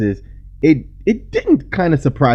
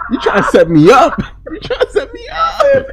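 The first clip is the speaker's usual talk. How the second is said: high, loud, laughing protest; 4 dB louder than usual. Real, not acted. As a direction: laugh it out, shocked. Laughing accusation at co-host.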